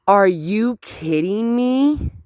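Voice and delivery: slow, high, drawn-out disbelief, climbing at the end; incredulous outrage. Real, not acted. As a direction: stretch it out in rising outrage. Outraged at the neighbors' silence.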